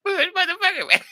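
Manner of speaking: high, laughing exclamation; laughing curse. Real, not acted. Amazed at his mother's patience, laughing curse. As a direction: blurt it out through laughter.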